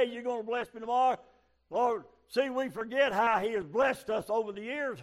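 high, loud revival preaching; full-force preaching. Acted. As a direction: preach it out to the congregation.

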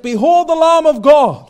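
high, loud declaration; proclaiming. Acted. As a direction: announce it to the crowd. Quoting scripture proclamation.

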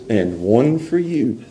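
low, slow and soft; hushed, low, unhurried handout; matter-of-fact payout. Acted. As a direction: hand it over quietly, slowly. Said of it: "and he says".

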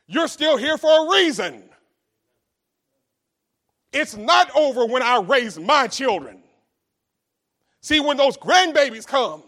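loud, rising preacher's cry; preaching at full force. Real, not acted. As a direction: preach it out to the congregation.

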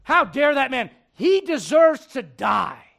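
outraged king. Retold or imitated. David judging the rich man, furious outcry. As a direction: rage at the injustice.

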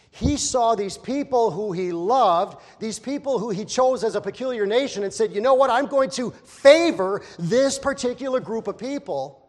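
loud, high, preaching shout; righteous indignation. Acted. Preacher shouting about God's chosen people. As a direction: thunder it out with indignation.

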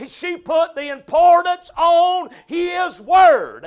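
loud, driving pulpit cadence, stretching the key words; insistent preacher building emphasis; full-force preaching. Acted. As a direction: hammer each repeated phrase.

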